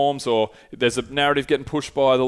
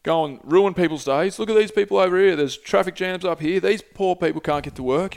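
higher, indignant lecturing tone; scolding journalist; 6 semitones higher than usual. Retold or imitated. Journalist berates activist over traffic jams, indignant and higher. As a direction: wag your finger at the protester, voice pitched up. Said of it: "He's like, and he's like".

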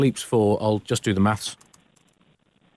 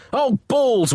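higher pained groan; wincing; 11 semitones higher than usual. Acted. The Pope, wincing at what was painted on his ceiling. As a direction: wince at the sight. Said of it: "winced the Vatican vicar".

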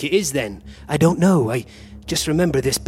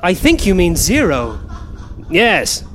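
usual speaking voice

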